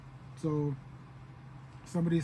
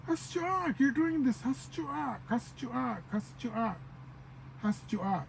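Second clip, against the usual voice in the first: high, hammed-up plea of a wronged lover; mock betrayed lament; 7 semitones higher, slower than usual. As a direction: wail "why" in mock heartbreak, much higher pitched. Acted betrayed lover asking why.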